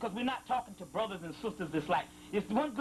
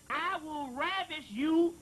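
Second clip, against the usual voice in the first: higher, slow, solemn intoning; scripture reading; 5 semitones higher, slower than usual. Acted. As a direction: draw out each word like prophecy. Reading a sacred passage aloud.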